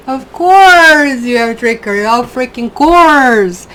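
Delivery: loud, slow, half-laughing exclamation; exasperated exclamation. Real, not acted. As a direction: drag it out, mock-weary at the card. Exclaiming at the drawn card.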